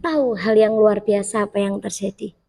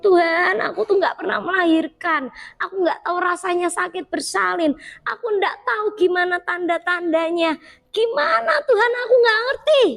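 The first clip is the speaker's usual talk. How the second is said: flustered plea; 7 semitones higher than usual. Retold or imitated. Frantic confused prayer.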